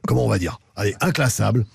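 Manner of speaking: ordinary delivery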